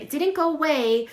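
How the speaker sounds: ordinary talk, nothing marked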